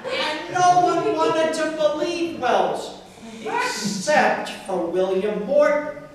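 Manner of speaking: deadpan comic poetry recital with deliberate phrasing; poem reading. Acted. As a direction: read it out line by line to an audience, punching key words.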